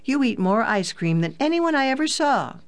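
high-pitched mock accusation; pretended anger. A narrator's reading. Daughter teasing her brother about ice cream, mock anger. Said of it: "cried out with pretended anger".

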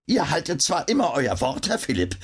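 lightly voiced high old skinflint; a touch of high-pitched miserly haggling. A narrator's reading. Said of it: "erwiderte der Geizhals".

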